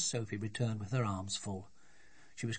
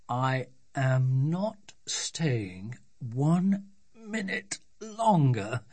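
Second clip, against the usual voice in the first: very slow, word by word; fed-up dad, deliberate and drawn out; emphatic refusal; 3 semitones higher, 5 dB louder, much slower than usual. Acted. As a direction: refuse with every word separated. Fed-up father refuses to stay.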